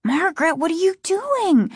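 put-on frightened boy's high cry. A narrator's reading. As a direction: panicky high-pitched question. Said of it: "his voice high and frightened".

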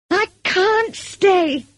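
high, drawn-out furious protest; furious cry. A narrator's reading. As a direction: cry out in fury, stretching the words. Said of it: "I cried furiously".

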